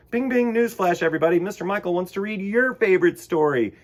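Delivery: put-on host announcing news to young viewers playfully; playful newsflash. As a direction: ring it out like a silly bulletin. Playful mock news alert.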